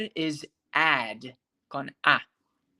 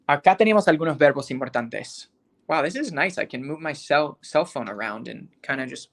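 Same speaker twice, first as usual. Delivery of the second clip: usual speaking voice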